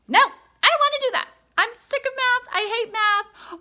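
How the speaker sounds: put-on high, loud whining kid; whiny child. Son whining to mom about doing math. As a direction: whine it like a child refusing math. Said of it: "Like whining, crying, fussing".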